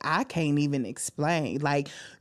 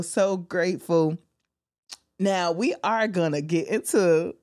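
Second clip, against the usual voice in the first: normal talking voice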